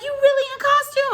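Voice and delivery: very high, loud, fast disbelief; amazed. Retold or imitated. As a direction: squeal it in total disbelief. Homeless man amazed at costume.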